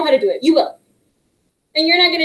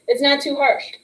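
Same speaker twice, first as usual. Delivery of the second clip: ordinary talk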